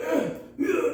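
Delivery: high mock grunts; mock grunting. Mocking Drew at the counter with high grunts. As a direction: grunt like you are heaving a heavy body. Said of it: "I start acting like Drew".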